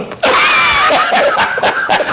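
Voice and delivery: big real laugh. Real, not acted. Laughs at own story.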